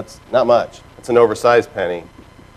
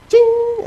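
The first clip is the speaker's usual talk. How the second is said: high sung ching like a till; cash register ching. Pretend. Speaker mimics a till for the crowd, high ching. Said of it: "I need a sound effect to go with that".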